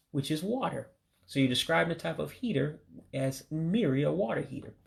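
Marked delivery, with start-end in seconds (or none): none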